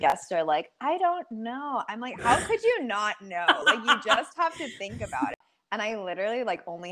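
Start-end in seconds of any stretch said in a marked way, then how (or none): 0.8-1.8 drawn-out, much higher dopey shrug voice; slow, high mock-vacant I don't know; mock clueless guest. Mocking guests' blank answer. 2.2-5.4 higher, laughing exasperation at clueless guests; amused mock-exasperated retort, faster at the end; laughing disbelief. Retold exasperated reply, laughing.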